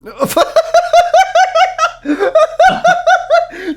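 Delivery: shrieking burst of laughter; loud high laugh. Real, not acted. Friend's funny "Food" answer.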